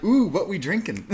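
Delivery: high, nosy party-guest ooh; mock eager curiosity. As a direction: imitate someone keen to join the drinks. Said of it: "I love how they're like".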